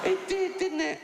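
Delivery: very high, stunned "it did, didn't it"; incredulous squeak. Real, not acted. Comic stunned by the Holocaust tweet, very high squeak. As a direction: squeak out the disbelief.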